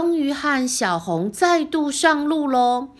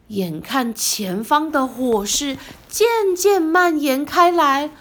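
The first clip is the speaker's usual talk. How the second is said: ordinary delivery, nothing marked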